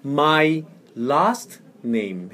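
"My... last... name" one word at a time; teacher's slow, careful model; slow model reading. Shown as a demonstration. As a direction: separate each word for the learner. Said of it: "해보세요".

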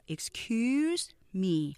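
slow "Excuse me" with a drawn-out CUSE; stress on second syllable. Shown as a demonstration. Teacher modelling back stress for learners, "Excuse" stressed on CUSE. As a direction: lean long on the end of "Excuse", ex-CUSE me. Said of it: "뒤에 강조하셔야 돼요".

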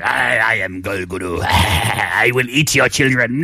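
slow, booming monster threat. Pretend. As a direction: growl it like a child-eating monster. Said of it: "The monster".

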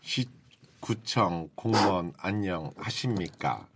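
low, slow civil servant voice; lightly voiced stiff official; switching impression voices. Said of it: "성대모사를 해".

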